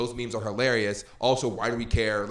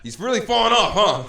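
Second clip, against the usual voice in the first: mock concerned dad; 10 semitones higher, 12 dB louder than usual.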